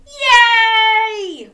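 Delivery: long, high, loud cheer. Acted. Getting listeners to join in, loud drawn-out cheer. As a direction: whoop it out for children to copy. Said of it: "Can you say".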